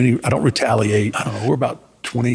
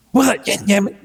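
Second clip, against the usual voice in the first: pissy Paul; 9 semitones higher than usual. Retold or imitated. Sulking Paul snaps back.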